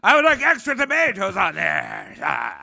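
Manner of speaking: high, loud, stiff military politeness; drill sergeant. Pretend. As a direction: bark the order like a soldier. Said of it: "You say".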